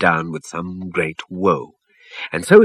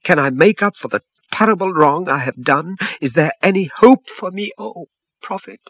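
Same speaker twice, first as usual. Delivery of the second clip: desperate pleading; 10 semitones higher than usual. A narrator's reading. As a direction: plead as if hope is slipping away. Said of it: "very distressed".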